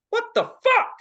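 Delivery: very high, loud disbelief; hammed-up squeal of bewilderment; shocked outburst. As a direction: shriek it in mock shock. Retold shock at stranger's remark.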